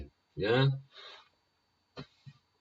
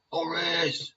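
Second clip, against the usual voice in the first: shouted name; 12 semitones higher than usual. Shown as a demonstration. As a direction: call Horace across a park. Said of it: "And you can shout it".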